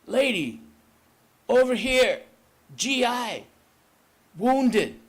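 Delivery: high, loud cry from the smoke; calling out. Acted. Wounded GI calls to a woman in the smoke, high loud cry.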